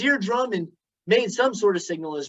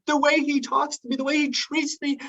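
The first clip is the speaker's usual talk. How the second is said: a higher, upset wife's voice; Cindy's voice; 8 semitones higher than usual. Retold or imitated. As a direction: complain about the rude boss. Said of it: "Cindy's like".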